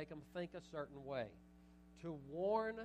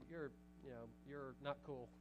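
dismissive thought; 3 semitones lower, 7 dB quieter than usual. Retold or imitated. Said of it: "the kind way of saying it".

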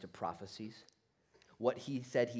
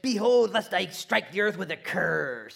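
loud, high scripture declamation; mock proclamation; 8 semitones higher, 13 dB louder than usual. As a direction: declaim the warning at full volume. Reader reciting Malachi's ending.

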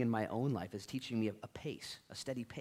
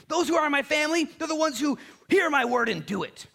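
much higher, louder pleading proclamation; imploring Jesus; 11 dB louder than usual. Acted. Preacher voicing Jesus imploring his hearers, loud and high. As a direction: cry it out like Jesus imploring the crowd.

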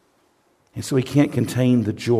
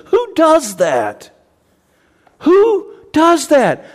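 high, slow, loud cry of disbelief; stunned, almost shouting; amazed wonder; 7 dB louder, much slower than usual. Real, not acted. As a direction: marvel at it, drawn out. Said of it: "preacher marvels".

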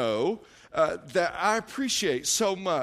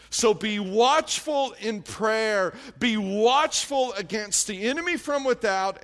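slow shouted exhortation; 2 semitones higher than usual. Real, not acted. Pastor calling congregation to prayer, slow shout. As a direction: command them to watch.

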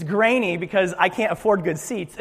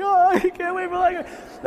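much higher, lightly put-on eager voice; mock excitement; 10 semitones higher than usual. Pastor imitating someone excited about Pop-Tarts, high mock-eager. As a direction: play a kid waiting on Pop-Tarts. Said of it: "You're just like".

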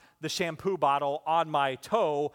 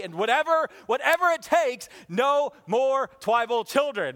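mock shout; 7 semitones higher, 6 dB louder than usual. Joking plea, no more children.

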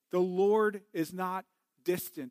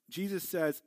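normal talking voice

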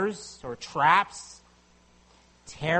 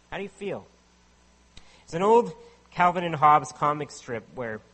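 ordinary talk, nothing marked

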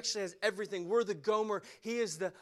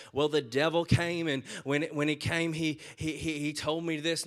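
louder, hammed-up stammering excuse; mocking excuse; 4 semitones lower, 6 dB louder than usual. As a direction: play the whiny blame-shifter, stumbling. Mocking an excuse about the devil.

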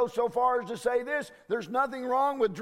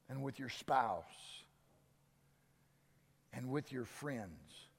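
low, hushed pastoral appeal, slowing at times; gentle preacher winding down to a near whisper; soft sermon close; 11 semitones lower, 8 dB quieter than usual. Acted. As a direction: draw the congregation in quietly. Soft closing exhortation of sermon.

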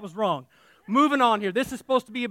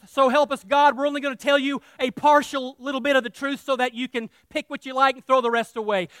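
normal talking voice

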